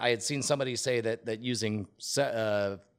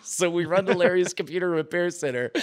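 higher, laughter breaking into the words; chuckling as you read on; laughing through; 4 semitones higher than usual. Real, not acted. Host laughing while retelling his adventure.

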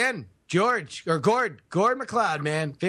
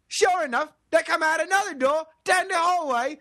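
high-pitched, excited British storytelling; comic British eyewitness, pitched up; mock British witness; 7 semitones higher than usual. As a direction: babble the tale in a hammed-up British voice.

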